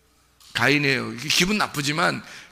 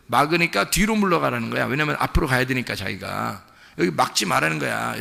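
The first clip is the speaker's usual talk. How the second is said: ordinary delivery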